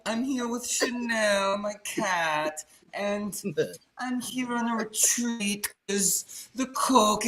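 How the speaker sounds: high, slow, grand diva drawl; boozy diva. Retold or imitated. Quoting the character's retreat line, high slow diva voice. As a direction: purr it like a jaded socialite.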